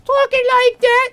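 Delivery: very high, loud falsetto demo; sudden piercing baby-talk squeak; high-pitched pet voice. Shown as a demonstration. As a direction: squeal it like you're exciting a dog. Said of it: "when she hears me talk like that".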